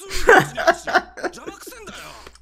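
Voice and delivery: long, high-pitched laughing fit; helpless laughter. Real, not acted. Viewer laughing at a clueless teammate, helpless laughter. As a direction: keep cracking up.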